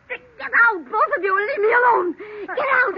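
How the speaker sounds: high, shrieking order to get out; furious screaming. Acted. Aunt screaming at niece and her husband, furious. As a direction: scream them out of the room, near tears.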